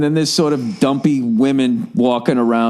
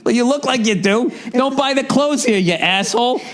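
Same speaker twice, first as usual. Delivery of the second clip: higher, huffy comeback as an insulted store clerk; offended store worker; 9 semitones higher than usual. Retold or imitated. Clerk offended at being asked if he works there, huffy higher voice. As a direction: snap back like a clerk who's been mistaken for staff. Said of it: "They get all offended".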